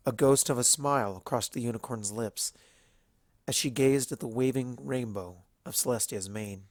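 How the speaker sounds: usual speaking voice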